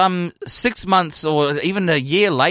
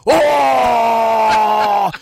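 loud yell into the mic, higher; drunken yell; 5 semitones higher, 5 dB louder than usual. Retold or imitated. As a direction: let out a wild drunken shout. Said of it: "Ye-, yelling".

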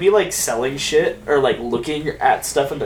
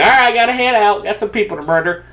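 put-on Old West accent; 8 semitones higher, 5 dB louder than usual. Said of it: "So they'd be like".

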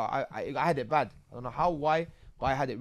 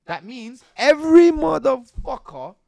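angry emphasis; 7 semitones higher, 10 dB louder, slower than usual. Real, not acted. Angry realisation about snitching.

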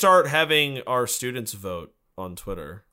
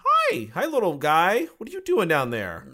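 cooing; 6 semitones higher, 3 dB louder than usual. Acted. Cooing at the little Pokemon.

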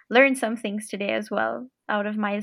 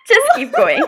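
giggling it out, high; laughing through the answer; 12 semitones higher, 11 dB louder than usual. Real, not acted. Laughing through rapid-fire answer.

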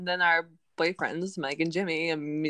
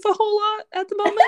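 mock whine; 7 dB louder, much faster than usual. Real, not acted. Self-pitying joke about life.

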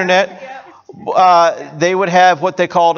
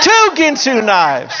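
home shopping host; 6 semitones higher, slower than usual. Retold or imitated. Continues infomercial pitch.

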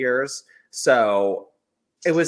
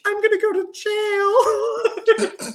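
mock panic; 4 dB louder, much slower than usual. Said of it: "I was like".

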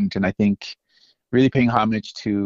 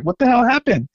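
alarmed question; 12 semitones higher than usual. Retold or imitated. Rocco backstage asking what went wrong, startled and high. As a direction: sound thrown by the news. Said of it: "And Rocco's like".

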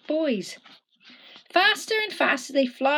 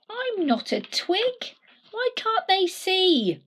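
high pleading whisper; 10 semitones higher than usual. Acted. Stickman protests, high whisper.

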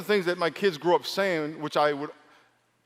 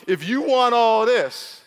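put-on sassy girl; 7 semitones higher, 6 dB louder, much slower than usual. Girl's meaning voiced sassily.

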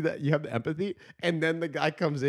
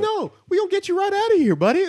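much higher, louder mock-friendly cop voice; hipster interrogator; 9 dB louder than usual. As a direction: ham up a chummy bow-tie detective. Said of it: "He goes".

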